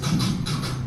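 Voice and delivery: unvoiced rhythmic thuds; imitated curb clunks. Car bumping back over the curb, imitated thuds. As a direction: knock out dull clunks with the mouth. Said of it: "it's like".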